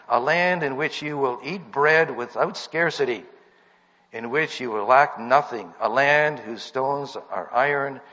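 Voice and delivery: loud, measured preaching cadence; emphatic scripture reading. Acted. Preacher reading the promised land to a congregation, emphatic scripture reading. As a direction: proclaim each phrase with punched key words.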